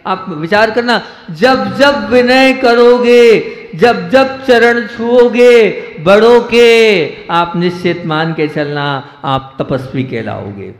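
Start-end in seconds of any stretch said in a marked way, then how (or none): 1.3-7.3 slow, higher, each phrase weighed; measured sermon cadence, slowed right down; slow emphatic preaching. Acted. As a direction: drag it out to press the lesson home. Preacher drives home the point.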